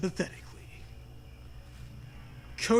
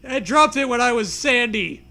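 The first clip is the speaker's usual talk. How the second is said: stammer; 5 semitones higher, 7 dB louder than usual. Acted. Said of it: "you stammer".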